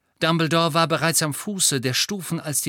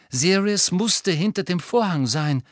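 plain reading voice

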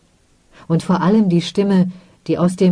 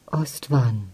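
softer, low and tender; gentle; 3 semitones lower, 6 dB quieter than usual. Acted. Mother gently calling her angry son's name. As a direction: call his name softly, like a mother. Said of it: "sagte sie sanft".

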